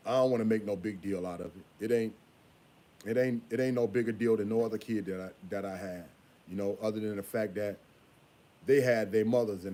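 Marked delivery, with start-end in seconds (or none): none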